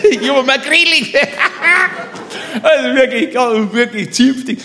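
laughing helplessly mid-story. Real, not acted. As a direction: giggle through the words.

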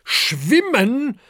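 high, loud disbelieving question; astonished cry. A narrator's reading. The parents cry out together in disbelief, loud and rising. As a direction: gasp the word in disbelief. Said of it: "rufen Adele und Herbert wie aus einem Maul".

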